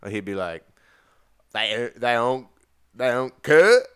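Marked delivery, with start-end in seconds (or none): 1.5-3.8 halting, higher, loud mock Southern-English accent; botched mix of Southern and English accents; Jesse Peterson doing English accent. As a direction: struggle loudly through a badly faked Southern-British accent. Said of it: "I wish I could hear Jesse Peterson's English accent".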